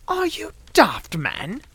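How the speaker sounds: high, slow bark of disbelief; incredulous, high-pitched challenge. A narrator's reading. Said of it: "he barked".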